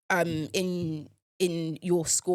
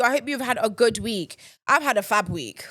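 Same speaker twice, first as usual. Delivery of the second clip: ordinary talk, nothing marked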